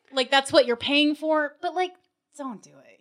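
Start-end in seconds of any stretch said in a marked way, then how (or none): 2.4-3.0 very soft, low aside; hushed. Real, not acted. Sudden whispered reversal.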